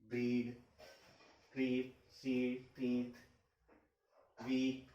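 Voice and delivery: slow, long ee in ब्रीड and क्रीप; stretched ee. Shown as a demonstration. Teacher repeats words with stretched ee. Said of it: "इसे stretch करते हुए बोलिए".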